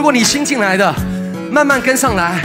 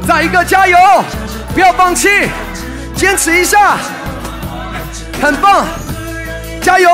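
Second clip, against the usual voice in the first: barking gym coach; 6 semitones higher than usual. Real, not acted. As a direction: push them through the last reps. Coach urging class to keep going.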